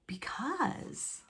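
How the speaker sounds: breathy suspense whisper. A narrator's reading. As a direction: hush it for suspense.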